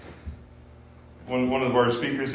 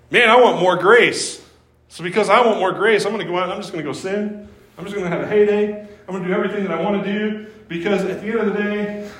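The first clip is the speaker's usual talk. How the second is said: higher, fast, careless mock sinner; selfish sinner; 7 semitones higher, 6 dB louder, faster than usual. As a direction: rattle it off like a self-indulgent believer. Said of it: "That's a pretty selfish way of thinking".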